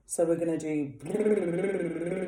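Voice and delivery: slow rolled brrr lip trill; lip trill demo. Shown as a demonstration. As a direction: buzz the lips gently on brrr. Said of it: "First exercise is on brrr".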